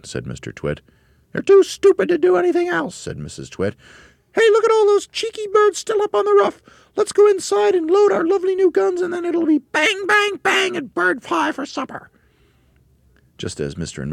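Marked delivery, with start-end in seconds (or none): 1.3-2.9 very high, loud, screechy character voice; scornful Mrs Twit. A narrator's reading. Mrs Twit mocks the monkeys to her husband, screeching. 4.3-12.1 very high, loud, screechy character voice; gleeful Mrs Twit. A narrator's reading. Mrs Twit plans shooting birds for supper, screeching.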